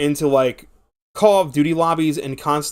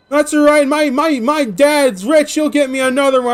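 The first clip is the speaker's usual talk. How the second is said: put-on high, louder spoiled child ranting; bratty kid; 9 semitones higher, 4 dB louder than usual. Kid smashing his controller, high bratty ranting. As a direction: rant like a spoiled rich kid smashing things.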